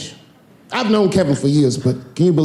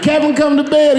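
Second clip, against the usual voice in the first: high, louder plea to bed; whiny wife; 11 semitones higher, 3 dB louder than usual. Retold or imitated. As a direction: call him sweetly from the bedroom. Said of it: "his wife be like".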